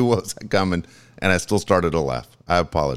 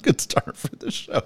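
laughing through words; 5 semitones higher, 5 dB quieter, slower than usual. Real, not acted. Laughing at awful joke.

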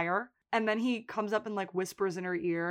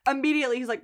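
ordinary talk, nothing marked

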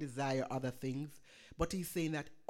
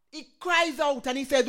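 rising, loud sermon delivery with surges; full-force preaching; 5 semitones higher, 7 dB louder than usual. Acted. Pastor exhorting the congregation in wartime fear, preaching at full force.

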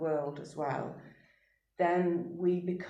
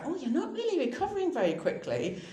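mock doctor; 10 semitones higher than usual. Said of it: "medical professionals go".

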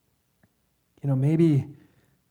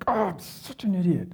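acted anger, pitched higher; angry self-berating; 5 semitones higher than usual. Shown as a demonstration. Preacher showing how he really talks to himself, angrily berating. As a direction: scold yourself in frustration. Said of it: "It's always angry".

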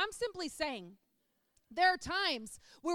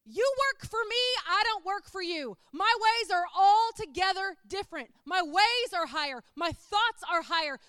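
loud, raised, emphatic preaching voice; Jesus as the boss; 5 semitones higher, 9 dB louder than usual. Acted. Preacher voicing God to doubters, loud and commanding. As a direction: proclaim each line at full force. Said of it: "Jesus is up there going".

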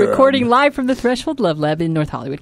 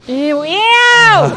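disgusted; 9 semitones higher than usual. Real, not acted. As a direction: squeal in disgust. Disgust at panties.

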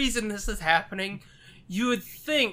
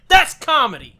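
emphatic; 3 semitones higher, 11 dB louder than usual. Pretend. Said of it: "You tell him, like".

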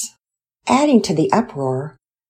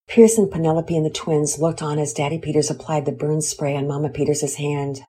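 usual speaking voice

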